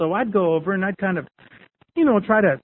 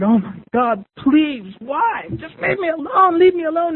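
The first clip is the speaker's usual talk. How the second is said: imitated tearful pleading; 10 semitones higher than usual. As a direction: pitch climbing into a high plea. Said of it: "she was crying and she said".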